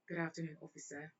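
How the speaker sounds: soft imitated British English; friend's put-on British politeness; British accent. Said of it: "broke in a one British accent".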